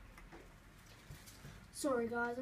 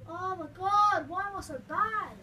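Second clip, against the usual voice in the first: frustrated outburst; 4 semitones higher, 8 dB louder than usual. Real, not acted. Kid gamer dies again and shouts at himself in frustration. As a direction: groan it out at yourself after dying.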